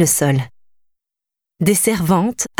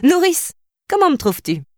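put-on high, playful girl showing off her dress; preening princess; 10 semitones higher than usual. A narrator's reading. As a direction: ask it high and coquettish. Princess shows off tunic.

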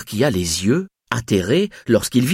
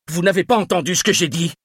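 fast, higher insistent demand; 8 semitones higher, faster than usual. A narrator's reading. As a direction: rattle it off, losing patience. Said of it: "insista Jean-Claude Bloch".

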